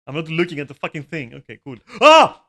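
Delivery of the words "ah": loud high yelp into laughter; yelp, then laugh it off; startled yelp. Real, not acted. Streamer caught glowing, startled yelp and laughter.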